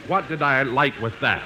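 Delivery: normal talking voice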